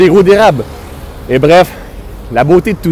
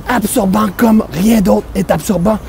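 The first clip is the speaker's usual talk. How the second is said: mock ad slogan; 5 semitones higher than usual. Mock ad pitch for baguette.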